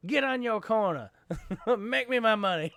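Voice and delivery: high, swaggering joke pimp voice; mock pimp. Bantering about money problems, mock pimp. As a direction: play a pimp demanding money, breaking into laughs.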